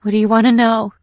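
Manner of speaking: mildly frightened question; fearful. A narrator's reading. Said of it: "she said fearfully".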